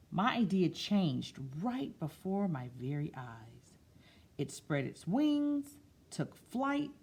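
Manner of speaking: slow storybook read-aloud; picture book voice; reading to children. Acted. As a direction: read it gently to a class.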